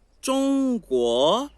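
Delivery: "中国" slow, high level then rising; first plus second tone. Shown as a demonstration. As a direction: hold "中" high, then lift "国" up. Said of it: "Primeiro e segundo". ("中国": primeiro tom plano, depois subindo)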